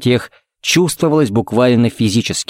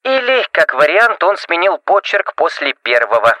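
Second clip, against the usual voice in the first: higher, filtered telephone voice; voice over phone; 6 semitones higher than usual. A narrator's reading. Rebecca suggests a theory during a call, telephone voice. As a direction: sound like you're on the other end of the line.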